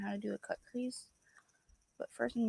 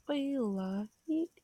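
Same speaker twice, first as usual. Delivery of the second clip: drawn-out, higher sing-song echo; slow sing-song; 4 semitones higher, much slower than usual. Real, not acted. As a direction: tease it out slowly and higher. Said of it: "repeats feel like it".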